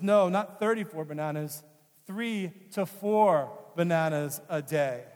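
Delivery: slow patient correction; doctor setting the nurse straight, slowing down; correcting doctor. Retold or imitated. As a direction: spell out three to four. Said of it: "the doc looks and says".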